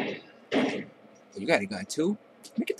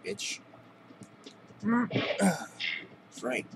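ordinary talk, nothing marked

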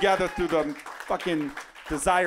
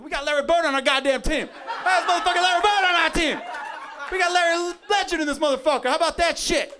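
much higher, loud triumphant yelling; ecstatic cheering; 13 semitones higher, 7 dB louder than usual. Retold or imitated. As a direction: celebrate like you have a legend. Team celebrates the swish.

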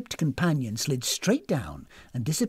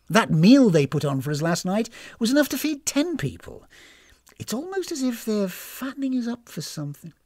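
higher, pointed voice of mistrust; suspicious woman character; 6 semitones higher, 5 dB louder than usual. Acted. Warning companion about overfriendly hosts, suspicious. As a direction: voice growing suspicion, leaning on key words.

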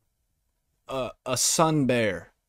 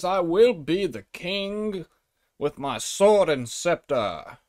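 mock British king. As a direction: play a babbling, pompous British king. Acts out the British king gloating.

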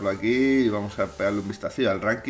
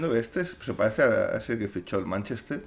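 usual speaking voice

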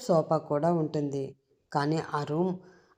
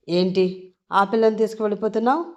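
louder, fast and menacing; villain stopping the husband, stern and louder; stern demand; 4 semitones higher, 6 dB louder than usual. A narrator's reading. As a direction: fire the question at him. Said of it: "అంటూ సీరియస్గా అడుగుతాడు".

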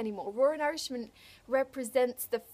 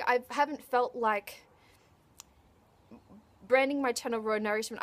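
ordinary talk

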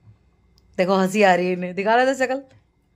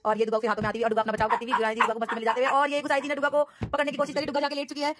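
fast sports-commentary patter; mock play-by-play; 4 semitones higher, much faster than usual. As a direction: call the dog fight like a match. (तेज़ खेल commentary अंदाज़ में)

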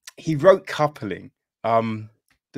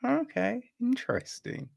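intrigued okay; 10 semitones higher, 6 dB quieter than usual. Real, not acted. Reacting to a surprising fan opinion.

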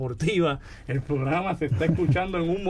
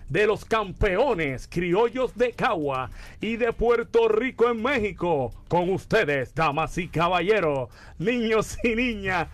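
booming ringmaster announcement, higher and slow; 5 semitones higher, slower than usual. Acted. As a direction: announce it big like a circus ringmaster. Introducing the mascot to the audience.

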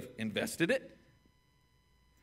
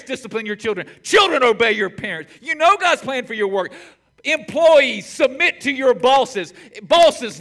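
loud, high pulpit declaration; forceful sermon list; fiery preaching; 10 semitones higher, 14 dB louder than usual. Acted. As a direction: preach it hard to the congregation.

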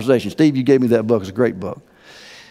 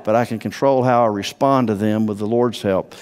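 normal talking voice

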